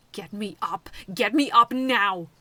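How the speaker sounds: loud, slow insistent demand. A narrator's reading. Robot Snow demanding to be freed, loud. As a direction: order it with force. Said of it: "Snow demanded".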